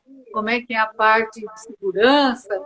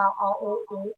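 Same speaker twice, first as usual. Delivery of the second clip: ordinary delivery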